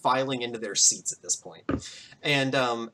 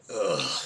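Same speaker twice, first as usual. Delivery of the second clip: low, quick ugh; low grunt of distaste; disgusted groan; 9 semitones lower, 3 dB quieter than usual. Acted. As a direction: groan in reluctant revulsion. Mayor disgusted before shaking hand.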